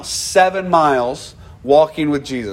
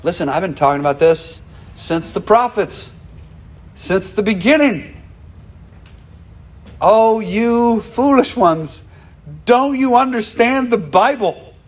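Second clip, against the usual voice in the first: rising to a higher pitch on foolish ones; emphatic appeal; 6 semitones higher than usual. Retold or imitated. Jesus urging his disciples, climbing higher and emphatic. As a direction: build up and plead, higher at the end. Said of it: "No, he says".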